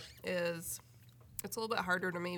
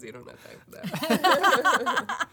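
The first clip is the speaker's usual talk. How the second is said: laughing; 6 semitones higher, 11 dB louder, much slower than usual. Real, not acted. Joking about two more kids.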